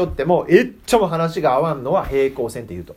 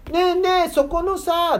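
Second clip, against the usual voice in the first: much higher cartoon child voice; Conan impression; slower than usual. Speaker mimics Conan for the children, high cartoon voice. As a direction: chirp it like the anime boy. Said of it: "とか言うのさ".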